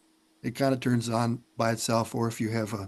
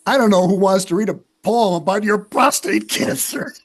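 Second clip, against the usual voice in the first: higher, louder mock sister voice; teasing sister; 7 semitones higher, 6 dB louder than usual. Sister teasing brother about his cancer poem, higher louder mock voice. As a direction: play the deadpan sister, pitched up and loud.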